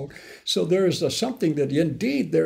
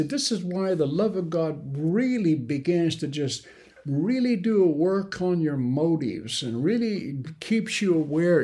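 ordinary talk, nothing marked

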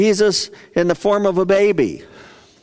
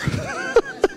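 quiet unvoiced laugh; breathy laugh; 5 dB quieter than usual. Real, not acted. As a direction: laugh off the punchline. Laughing at the punchline.

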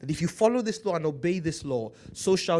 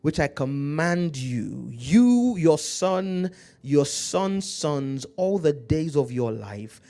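scripture preaching; 5 dB louder, slower than usual. Acted.

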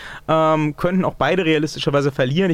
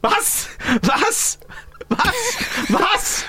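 high disbelieving what; surprised; much slower than usual. Pretend. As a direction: squeak out shocked disbelief.

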